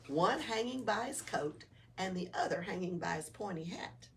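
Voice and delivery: ordinary delivery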